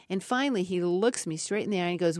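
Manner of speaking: ordinary talk, nothing marked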